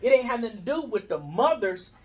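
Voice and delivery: ordinary talk, nothing marked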